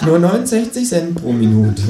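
high, cheeky kangaroo voice; squeaky-high kangaroo. Acted. Kangaroo character voice.

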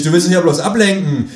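high, loud protest; high, loud indignant call. Acted. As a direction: call him out loudly. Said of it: "rufe ich".

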